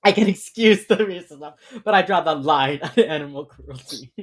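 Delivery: loud, emphatic sitcom quote; Britta. Retold or imitated. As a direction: declare it boldly, imitating a TV character. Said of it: "Britta, who is".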